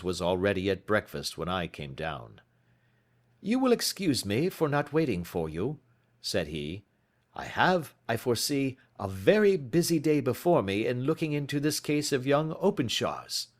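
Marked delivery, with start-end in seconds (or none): 3.4-5.8 a hint of a brisk detective, slightly higher; crisp, clipped gentleman sleuth, lightly done; lightly voiced Holmes. A narrator's reading. Holmes greets Watson at breakfast, lightly voiced. 7.3-13.5 a hint of a brisk detective, slightly higher; crisp, clipped gentleman sleuth, lightly done; lightly voiced Holmes. A narrator's reading. Holmes greets Watson at breakfast, lightly voiced.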